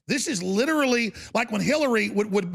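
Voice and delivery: ordinary delivery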